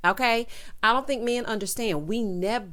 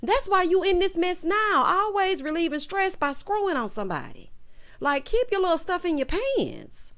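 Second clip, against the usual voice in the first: exasperated yell; 10 semitones higher than usual. Retold or imitated. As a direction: yell at him like a fed-up auntie. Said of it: "I'm like".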